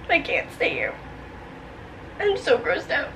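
ordinary talk

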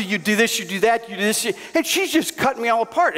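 ordinary delivery

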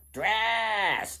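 shrill-pitched goblin calling out; high goblin cry. Acted. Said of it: "they mumbled as they went".

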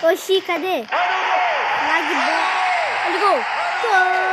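high, loud goal shout; explosive goal commentary. Pretend. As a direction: build up and scream the goal. Goal scored in game commentary.

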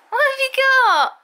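excited dog play talk. Real, not acted. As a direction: hype the dog up for his ball.

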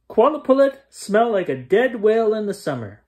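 high, loud and fast taunt; yelled taunt. Acted. Girl taunts the sea creatures, high and loud. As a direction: fling the insult across the ice. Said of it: "Alashua yelled".

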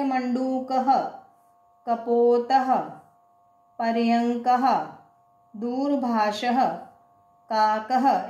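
each word said separately, the final ः in "कपोतः" sounded as a breath of "h"; voiced visarga. Shown as a demonstration. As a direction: end "मंडूकः", "कपोतः", "पर्यंकः", "दूरभाषः", "काकः" on an audible "ha". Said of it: "है की sound आती है ना जब अपन बोलते हैं". ("मंडूकः", "काकः" के अंत में विसर्ग का "ह" साफ़ बोलते हुए)

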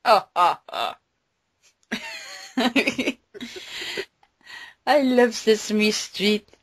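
ordinary talk

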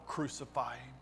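hushed, low cry of the mob; quiet, dropped-voice echo of a shout; understated roar. A narrator's reading. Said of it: "roared even louder".

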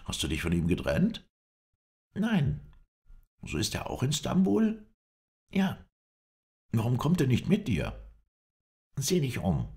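high, eager voices trading questions; eager and animated; excited reunion. A narrator's reading. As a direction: lively pitch leaps in happy surprise. Excited reunion, rapid questions and answers.

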